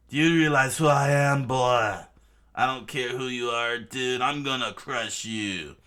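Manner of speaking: acted furious yelling at an opponent; enraged shout. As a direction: start at a full shout, then ease slightly. Crocodile rages at Luffy.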